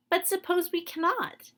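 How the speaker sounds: put-on high girlish voice, anxious question; worried little girl. Girl Dorothy doubts they can win, high and worried. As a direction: ask like a small girl fearing the worst.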